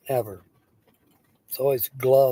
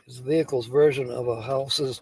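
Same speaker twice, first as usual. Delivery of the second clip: ordinary talk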